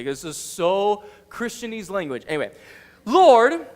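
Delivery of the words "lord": loud, higher call for attention; exasperated outburst. Acted. Frustrated Martha talking to Jesus, loud exasperated appeal. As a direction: call out sharply, fed up. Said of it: "this is how crazy she's getting".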